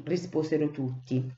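plain reading voice

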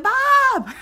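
calling out. Retold or imitated. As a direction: yell across the crowd to get his attention. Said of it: "I was like".

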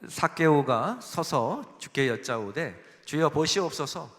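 slow, measured reading of a verse together; scripture reading aloud. Shown as a demonstration. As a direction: read the verse slowly with the congregation. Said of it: "팔 절 한번 같이 읽어볼까요".

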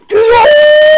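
high, loud cry; panicked shout. Real, not acted. Kid attacked by a game monster, high panicked shout. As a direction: shriek it as you're grabbed.

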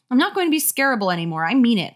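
determined, rapid protest; firm vow. Acted. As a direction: vow it with force. Acted firm vow to friend.

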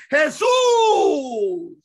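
high, loud, drawn-out "Jesús"; exasperated cry. Real, not acted. Preacher answering his own question, exasperated cry. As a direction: call out the answer at full voice.

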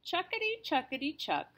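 chugging machine noise, high and bouncy; machine sound effect. Acted. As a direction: chant it like a clanking machine.

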